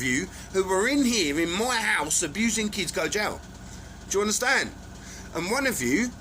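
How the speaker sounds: loud, high, furious threat; furious vow. Real, not acted. Vowing to jail the abusers, loud and furious. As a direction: bellow it like a promise of revenge.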